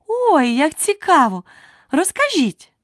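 high-pitched, excited little plea; eager curiosity. Acted. Alice begs the knight to explain his invention, eagerly curious. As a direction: burst out curious, pitch leaping up.

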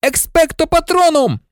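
high-pitched cry of an incantation; shouted spell. A narrator's reading. Harry casting a spell at the Dementors, shouting. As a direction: call the spell out high and forcefully. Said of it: "закричав".

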